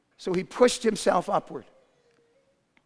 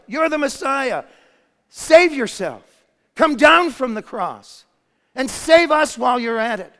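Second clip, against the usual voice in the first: loud, higher mocking jeer; taunting; 5 semitones higher, 7 dB louder than usual. Thief taunting the dying Jesus, loud and mocking. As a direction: throw the taunt up at him. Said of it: "began to taunt him".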